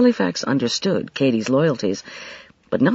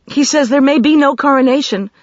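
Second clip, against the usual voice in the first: put-on high, louder, hurried young voice; rush of words; 11 semitones higher, 6 dB louder than usual. A narrator's reading. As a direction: rush the news out breathlessly. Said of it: "in a rush of words".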